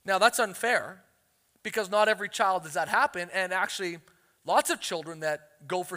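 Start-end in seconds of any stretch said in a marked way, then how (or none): none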